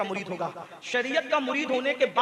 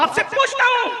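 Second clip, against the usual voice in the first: high, loud preacher's challenge; ringing demand; challenging cry; 12 semitones higher, 10 dB louder than usual. Real, not acted. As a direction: throw it out to the whole crowd.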